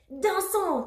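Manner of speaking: high, loud rallying cry; shouted. A narrator's reading. Teddy starting the disco party, shouting. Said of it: "cria Teddy l'ourson".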